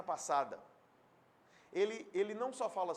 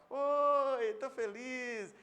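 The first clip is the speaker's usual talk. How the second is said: fake cheer; 5 semitones higher, 5 dB louder, much slower than usual. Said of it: "sorriso superficial".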